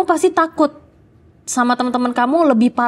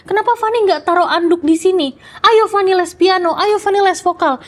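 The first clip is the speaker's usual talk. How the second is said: higher, playful mock mom scolding; lightly voiced fussy mother; imitating nagging mom; 7 semitones higher than usual. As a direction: mimic a mother nagging her child. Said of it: "pasti mama aku bilang".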